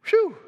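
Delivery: high, loud mock sigh of relief "Phew"; relieved phew. Preacher voicing relieved single listeners with a loud high "Phew". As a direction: blow out a big relieved "Phew".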